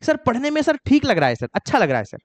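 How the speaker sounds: teacher mimicking students who trust feel over grammar, mock voice. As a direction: play the student defending his bad English, lightly. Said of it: "यही लोग का, आपका भूल होता है".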